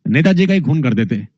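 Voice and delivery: ordinary talk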